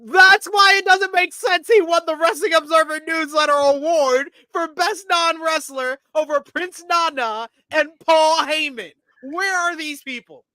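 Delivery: loud, high-pitched disbelief; exasperated rant. Real, not acted. Host objects to an award going over Paul Heyman, exasperated rant. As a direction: rant indignantly at the top of your range. Ranting about a baffling award.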